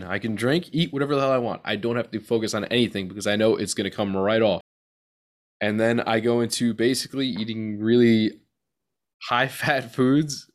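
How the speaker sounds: normal talking voice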